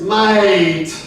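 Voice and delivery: "mate" stretched long, like "maa-iit"; drawn-out Aussie "mate". Shown as a demonstration. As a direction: draw out the vowel in "mate" Australian-style. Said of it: "other big word you have to learn".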